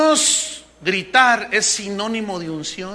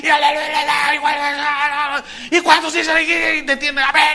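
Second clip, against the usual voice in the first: loud, higher hammed-up preacher cries; imitating a yelling pastor; mock shouting preacher; 6 semitones higher, 5 dB louder than usual. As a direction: shout it like a noisy revival preacher.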